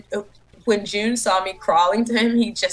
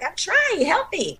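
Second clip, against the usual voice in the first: much higher, strained mock plea; mock whine; 10 semitones higher than usual. Performer stuck in the hole pleading with J J, high whine. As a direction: squeak it out like you're stuck. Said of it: "I'm like".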